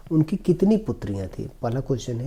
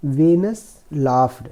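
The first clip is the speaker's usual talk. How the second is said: slow, careful reading of the English passage; slow reading aloud; 5 dB louder, much slower than usual. Shown as a demonstration. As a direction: read each word slowly for the class. Teacher reads story slowly. (धीमी आवाज़ में अंग्रेज़ी पढ़ना)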